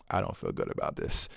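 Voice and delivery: soft, unvoiced guilty murmur to himself; uneasy murmur. Father alone in the car, soft guilty murmur. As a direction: say it under your breath, uneasy.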